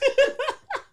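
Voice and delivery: sudden high-pitched laugh. Real, not acted. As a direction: burst out in a high cackle. Bursts out laughing.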